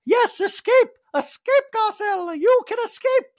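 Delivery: very high, loud cheering squeal; Dorit impression. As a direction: shriek encouragement like an overexcited fan. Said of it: "if Dorit kept going".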